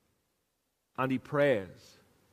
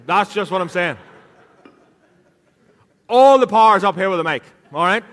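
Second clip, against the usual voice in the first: loud, mock-indignant telling-off of a friend; playful loud protest; 3 semitones higher, 8 dB louder than usual. Real, not acted. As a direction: boom it over the mic at him. Preacher bantering with William in congregation.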